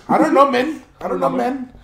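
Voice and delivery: put-on "men" said with a short e, Mexican dad accent; mock Mexican dad accent, "men" with an e sound; Mexican dad saying men. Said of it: "love to say the word men".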